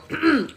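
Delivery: a loud, pointed throat clearing; boy clearing his throat before interrupting the Tin Woodman. Acted. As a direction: clear your throat to get attention. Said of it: "Connor cleared his throat".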